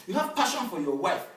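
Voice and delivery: ordinary delivery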